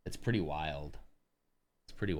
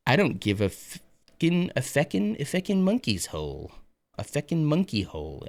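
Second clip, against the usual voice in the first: imitating Irish coworker; 7 semitones higher than usual. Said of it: "I can't do the accent".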